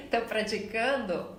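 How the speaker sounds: ordinary delivery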